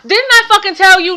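mock-exasperated telling-off; retold scolding. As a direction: scold them in a playful retelling. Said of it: "Caught him and then was like".

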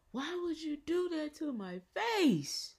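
high, loud, drawn-out mock wail; imitated distraught grandmother. Grandmother upset about the face, imitated high wail. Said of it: "And she was like".

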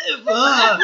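loud, high startled "ugh"; blurted fright noise, pitched high; startled yell. Retold or imitated. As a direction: re-enact a jolt of fright, loudly. Retelling a frightened run past her.

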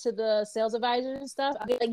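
usual speaking voice

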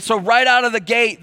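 ordinary talk

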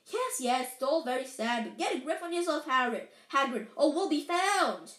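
usual speaking voice

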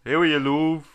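put-on a light Dublin accent greeting. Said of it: "Dublin accent".

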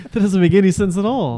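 laughing high and loud through the words; can't stop laughing while you say it; a big laugh breaking over every word. Real, not acted. Laughing at mom's logic.

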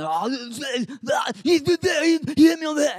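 frantic put-on child complaint; squabbling kids. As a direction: imitate kids tattling over each other. Kids bickering after the fight.

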